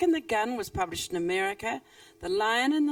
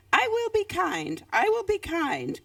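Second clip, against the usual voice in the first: higher, firm lizard teaching a chant; mild higher lizard voice teaching; coaching mantra; 10 semitones higher, 4 dB louder than usual. Acted. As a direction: say it like a lesson to repeat.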